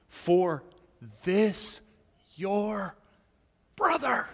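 each word on its own, brother lifted high; "for", "this", "your", "brother" spaced apart, "brother" hit high; slow word by word. Real, not acted. Father stressing to his elder son that he is his brother, word by word.

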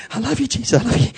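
high, quick, nervous little prayer; scared prayer. Retold or imitated. Scared boy praying alone in a dark room, nervous high prayer. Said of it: "Scared of the dark".

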